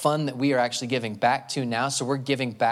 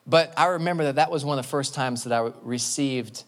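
ordinary talk

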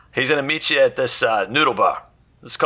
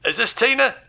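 put-on London geezer accent, high and brisk; cockney fixer voice; 6 semitones higher than usual. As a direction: play a pushy cockney fixer on the phone. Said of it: "the accent was tuned to perfection right there".

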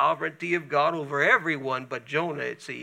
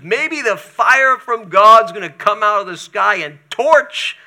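angry Jonah; 3 semitones higher, 12 dB louder than usual. Acted. Jonah hoping for fire.

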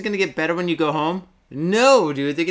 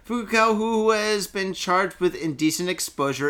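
ordinary talk

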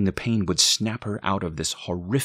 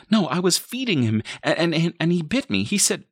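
high, louder, stumbling words; frantic explaining; 12 semitones higher, 5 dB louder than usual. A narrator's reading. Mother explains bite to boyfriend, high frantic stammer. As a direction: stammer through it in a panic.

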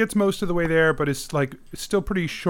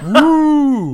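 loud, high whoop; hollered crowd cheer; 9 semitones higher, 9 dB louder than usual. Acted. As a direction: cheer like a fan in the stands. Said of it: "is cheering".

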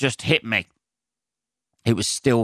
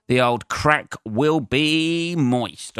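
high, slow drawn-out comic voice; put-on slow voice; 6 semitones higher, much slower than usual. Real, not acted. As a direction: stretch the line out in a silly voice. Crude joke in odd voice.

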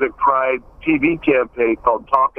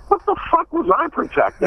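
incredulous. Retold or imitated. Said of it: "I'm like".

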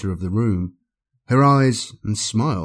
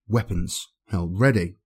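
ordinary delivery, nothing marked

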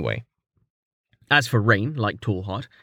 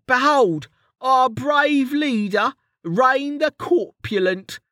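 high, slow, grand mock announcement; Kettle's mock herald; 13 semitones higher, slower than usual. A narrator's reading. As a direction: proclaim it like a pompous herald.

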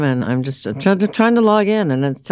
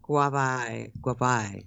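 put-on little Jamaican accent; 2 semitones lower, 10 dB quieter, much slower than usual. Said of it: "like a little accent, like maybe a Jamaican".

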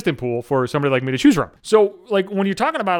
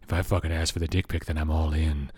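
much lower and softer, a sultry seductive murmur; phone sex voice; 11 dB quieter than usual. Pretend. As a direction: purr it low like a hammed-up late-night hotline. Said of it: "phone sex voice [name]".

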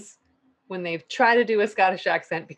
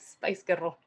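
imitated Scottish accent; 4 semitones lower, 8 dB quieter than usual. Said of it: "in a Scottish accent".

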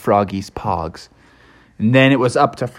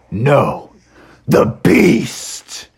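voiceless, dramatic dread; ominous rasp; much slower than usual. A narrator's reading. Friends warn Froggy of the beast, ominous rasp.

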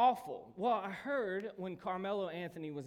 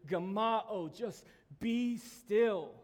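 loud, raised, drawn-out command; 4 semitones higher, 7 dB louder, much slower than usual. Retold or imitated. Jesus quieting our soul, commanding. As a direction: stretch BE STILL slow and strong. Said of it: "To quiet our soul with".

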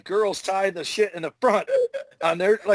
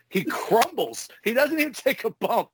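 normal talking voice

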